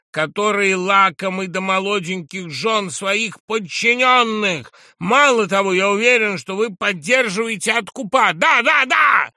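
high, loud accusations building to a shout; unrestrained fury. A narrator's reading. Drunk journalist accuses the general of corruption, unrestrained fury. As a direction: pile on the charges, ending in shouted yeses. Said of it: "продолжал кричать с неудержимой яростью".

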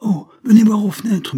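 crié fort et très aigu; cri soudain. A narrator's reading. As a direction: appeler les invités aux fenêtres en criant. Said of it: "en criant tout à coup". (cri brusque et aigu)

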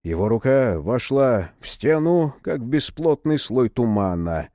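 ordinary delivery, nothing marked

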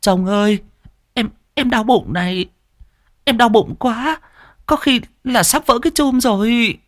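high, halting wife's voice; wife telling her husband the baby is coming; wife stammering in labour pain. Acted. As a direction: stammer through the labour pains. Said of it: "Mụ lắp bắp".

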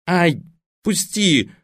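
high pained yelp; yelping girl. A narrator's reading. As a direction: squeal to be let go. Girl grabbed by the ear. (вскрик высоким голосом)

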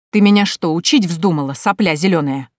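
loud, indignant bark; outraged, forceful scolding; angry bark. Acted. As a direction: bark it like an insulted uncle. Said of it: "рявкнул он".

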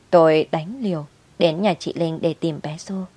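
plain reading voice

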